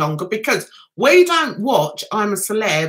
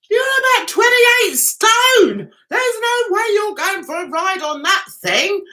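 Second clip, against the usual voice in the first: incredulous scolding; 9 semitones higher than usual. Retold or imitated. Telling the neighbour he's too heavy to ride, mock disbelief. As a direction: put him down with mock disbelief.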